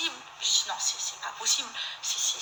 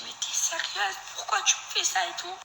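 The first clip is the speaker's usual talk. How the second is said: much higher, breaking with tears; crying disbelief; 9 semitones higher than usual. Retold or imitated. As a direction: ask through sobs why she did it. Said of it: "je suis en pleurs". (incrédulité en pleurs, voix très aiguë)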